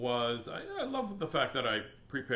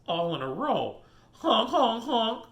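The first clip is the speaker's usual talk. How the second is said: loud high honking; goose honks; 9 semitones higher, 9 dB louder, slower than usual. Acted. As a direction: honk like a goose. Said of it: "says the geese".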